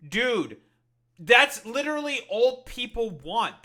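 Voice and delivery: fed-up loud rant; exasperated rant. Real, not acted. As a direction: shout the frustration out. Ranting at Democratic leadership.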